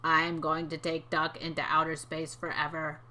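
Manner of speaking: low, slow robot villain; drawn-out, low robotic villain voice; evil robot. Acted. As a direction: deliver a menacing threat in a low monotone. Story's evil robot threatens Duck.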